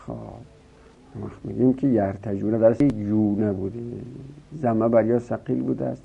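ordinary delivery

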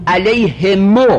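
علیهمو said slowly, h with kasra, mim with damma; slow demonstration. Shown as a demonstration. Teacher modelling the damma on the mim, slowly. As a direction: drag out علیهمو with a rising end. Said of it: "کسرها ضم میم".

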